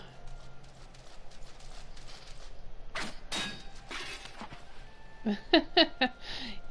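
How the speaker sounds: high chuckling laugh; real laugh. Real, not acted. Player amused by the game fight, laughing. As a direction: break into high, bouncy giggles.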